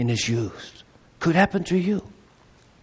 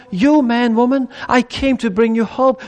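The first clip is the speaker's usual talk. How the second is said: normal talking voice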